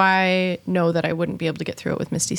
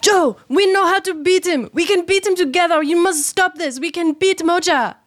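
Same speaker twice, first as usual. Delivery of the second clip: raised voice calling through a door; shouting through a doorway; 9 semitones higher, 4 dB louder than usual. Acted. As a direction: call out loud to someone in another room. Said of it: "shout through the doorway as best I can".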